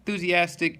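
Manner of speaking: enthusiastic. Real, not acted. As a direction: read it with an upbeat lift. Livelier redo of the word.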